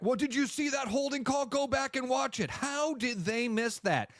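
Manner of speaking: high-pitched mock outrage; indignant fan. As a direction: imitate an incredulous fan complaining at the replay. Mimics indignant fan watching replay.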